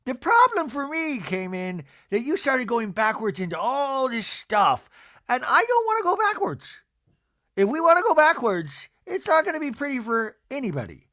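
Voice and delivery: stretched, affected reality-star voice; Meredith impression. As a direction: warn them in a put-on high voice. Mimics Meredith warning Andy.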